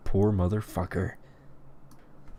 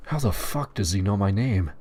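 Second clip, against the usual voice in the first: ordinary delivery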